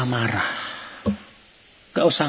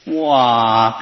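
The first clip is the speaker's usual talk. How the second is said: ordinary delivery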